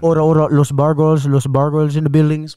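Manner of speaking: loud club MC shout-out; booming hype-man call; Fat Boy. Retold or imitated. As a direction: announce the crew over a club mic. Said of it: "Fat Boy was on the mic".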